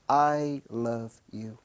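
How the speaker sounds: slow, soft and tender; a dying farewell, slow and gentle; tender last words. Retold or imitated. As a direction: say it like a final goodbye on the phone. Last phone calls from doomed towers.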